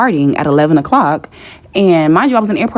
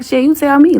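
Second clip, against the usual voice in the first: exasperated high quip; 10 semitones higher than usual. Retold or imitated. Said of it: "So I'm like".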